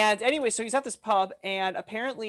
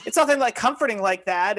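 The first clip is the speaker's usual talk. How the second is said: ordinary delivery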